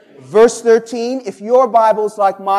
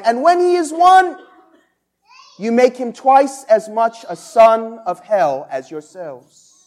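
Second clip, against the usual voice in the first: rising fury against the hypocrites; preaching fiery woe; 4 dB louder than usual. Acted. Pastor voicing Jesus condemning the Pharisees, fiery preaching.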